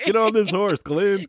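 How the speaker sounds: bossy mock Maggie, laughing. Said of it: "co-host jokes about Maggie".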